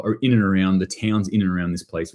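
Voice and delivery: ordinary delivery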